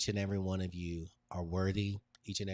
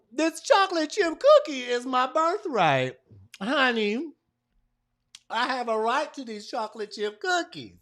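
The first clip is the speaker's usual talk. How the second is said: much higher, louder playful hype; excited hype; 12 dB louder than usual. Real, not acted. Speaker hyping the room before sharing her screen, excited. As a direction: bubble over with excitement.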